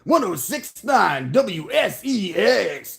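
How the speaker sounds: slow, high radio DJ station ID; radio station read-in. Acted. Streamer doing a DJ station read-in, slow and high. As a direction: announce the call letters like a DJ. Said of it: "did the read-in".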